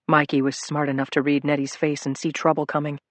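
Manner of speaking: ordinary delivery, nothing marked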